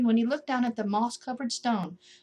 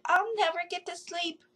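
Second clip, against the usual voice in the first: high, sleepy complaining voice; a cross little voice under the ground; grumpy voice from below; 9 semitones higher than usual. A narrator's reading. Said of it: "a voice said".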